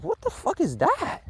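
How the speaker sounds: high, disbelieving outburst; incredulous. Retold or imitated. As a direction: act baffled by the claim. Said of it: "I'm like".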